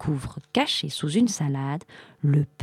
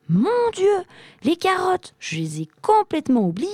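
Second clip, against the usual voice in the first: exclamation très aiguë, paniquée, qui s'accélère; réalise soudain la catastrophe; affolé; 13 semitones higher, 3 dB louder than usual. A narrator's reading. Arthur réalise son oubli. (surprise paniquée, voix très haute)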